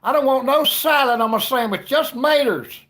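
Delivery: very loud, high Southern holler. Acted. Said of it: "they would call it salad".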